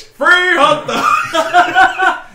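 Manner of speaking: high, very slow, drawn-out creepy voice with a laugh; mock creepy offer. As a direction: stretch it out like a dodgy stranger luring kids. Mocking S1's creepy-sounding line.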